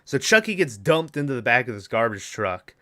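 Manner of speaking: usual speaking voice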